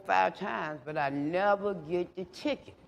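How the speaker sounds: ordinary delivery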